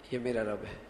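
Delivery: یہ میرا رب ہے low and soft, as plain information; quiet, low declarative یہ میرا رب ہے; statement tone. Shown as a demonstration. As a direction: state یہ میرا رب ہے as settled fact, falling. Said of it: "یہ خبر ہے".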